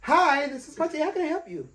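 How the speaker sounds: high, sugary mock customer-service greeting; professional phone voice. Friend mimics him answering a customer's call, professional voice. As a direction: switch up high, stretch it out politely. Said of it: "a professional voice".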